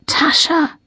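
high, strained call; struggling to get words out; barely able to speak. A narrator's reading. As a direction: force the name out weakly, pitched high. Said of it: "Lily can just say".

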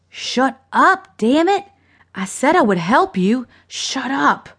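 high, exasperated scolding; angry hushing. A narrator's reading. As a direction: silence someone who won't stop yelling. Woman snaps at screaming man.

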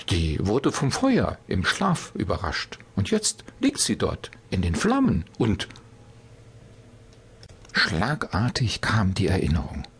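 usual speaking voice

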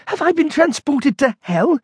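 high-pitched appalled exclamation; horrified panic. A narrator's reading. Monk back among the supermarket sights, horrified.

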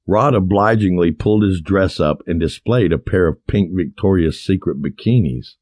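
usual speaking voice

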